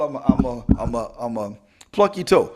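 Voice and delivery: low, halting playful warning; mock threat. Speaker warning the audience not to laugh at their school, mock threat. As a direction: drop your pitch for a joking threat.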